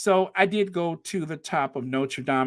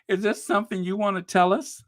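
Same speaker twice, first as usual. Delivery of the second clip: usual speaking voice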